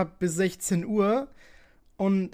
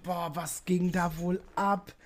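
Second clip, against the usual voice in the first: neugierige Zuhörer; much slower than usual. Retold or imitated. Alle fragen sich jetzt bestimmt.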